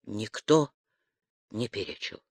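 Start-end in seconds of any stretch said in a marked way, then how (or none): none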